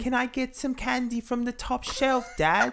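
put-on high child-to-dad character voice; changed voice. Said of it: "Can you change your voice?".

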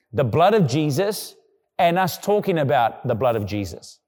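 preacher building to the key line; preaching at full force. Acted. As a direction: drive the point home with mounting fervour.

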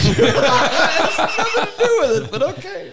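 high, laughing protest. Real, not acted. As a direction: squeak it out through laughter.

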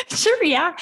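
high laughing sure; mock eager agreement through a laugh; laughing yes. Real, not acted.

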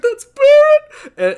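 high, slow, loud excited squeal; excited shout. Retold or imitated. Fan reliving the trailer reveal to his friend, squealing. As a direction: squeal it like a fan seeing a hero.

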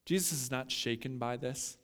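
normal talking voice

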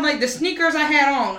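ordinary talk, nothing marked

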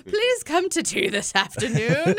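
high, loud mock British invitation; hammed-up British accent, laughing at it. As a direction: put on a silly, high-pitched British voice. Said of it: "with a very offensive British accent".

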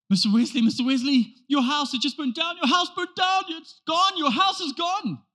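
high, urgent, breathless alarm; frantic man telling Wesley his house burned down; panicked messenger. Retold or imitated. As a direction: blurt it out in a panic, pitch climbing.

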